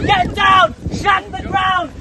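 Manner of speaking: loud barked orders, word after word; drill sergeant shouting. Acted. Drill sergeant bellowing orders at a teammate under the net. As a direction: bellow every command at them.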